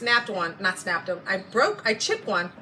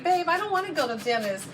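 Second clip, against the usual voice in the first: put-on higher, pleading baby whine to her husband; whiny little baby; 6 semitones higher than usual. Wife whining to husband about the dentist, babyish. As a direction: whimper it like a little kid who won't go. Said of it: "I was like a little baby".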